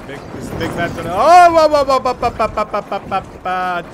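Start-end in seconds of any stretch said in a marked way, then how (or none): 1.1-3.9 loud high cry tumbling down to usual pitch; startled panic. Real, not acted. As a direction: burst out in alarm, then let the stammer sink. Big bat about to attack.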